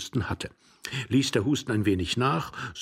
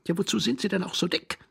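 higher, scornful question; mocking; 8 semitones higher than usual. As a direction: taunt him. Stranger mocking. (spöttisch)

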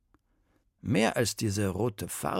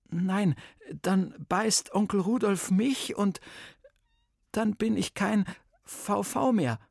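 put-on higher, eager boy's voice, stammering; nervous boy blurting out his trouble; 8 semitones higher than usual. Vampire boy explains to Bertha, nervous and higher.

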